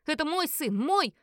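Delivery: high, loud hysterical outburst; истеричный крик. A narrator's reading. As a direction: cry out possessively in a rage. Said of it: "кричала истерично моя супруга". (истерично кричит, срываясь вверх)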